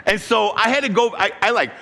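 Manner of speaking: ordinary talk, nothing marked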